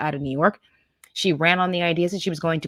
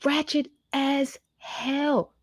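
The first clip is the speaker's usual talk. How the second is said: high, very slow and stretched; slow, high stretched jeer; drawn-out mock verdict; 9 semitones higher, much slower than usual. Real, not acted. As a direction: savour every word in scorn. Reacting to the clip's punchline.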